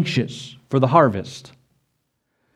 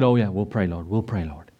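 mock sleepy disciples; 7 semitones lower, 5 dB quieter than usual. Mocking sleepy disciples' reply.

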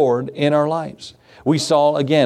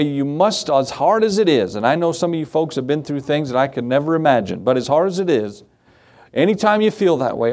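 ordinary talk

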